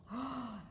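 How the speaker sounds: quick quiet gasp; a sharp hushed breath in; soft frightened gasp. Acted. Maggie spotting the drog, soft gasp.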